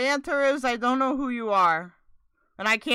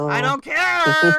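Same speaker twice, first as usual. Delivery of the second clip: slow, louder put-on shout; hammed-up rebellious cry; mock defiant yell; 4 semitones higher, 8 dB louder, slower than usual. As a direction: defy a warning theatrically.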